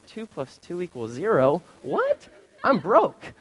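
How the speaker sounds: high-pitched incredulous outburst; mock disbelief. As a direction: squeak out the shock, half in jest. Acting out shock at being broke.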